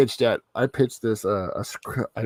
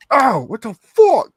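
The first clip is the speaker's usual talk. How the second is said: high, loud, drawn-out yelp; pained outburst; 13 semitones higher, 10 dB louder, slower than usual. Acted. Wolverine tied to the wall with darts in him, pained outburst. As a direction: cry out as if just jabbed.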